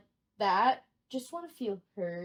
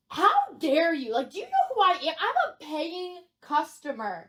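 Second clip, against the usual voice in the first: loud, higher-pitched mock tirade; outraged customer; 4 semitones higher, 4 dB louder than usual. Paying customer berating a trainee, loud and indignant. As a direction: tell them off in hammed-up indignation.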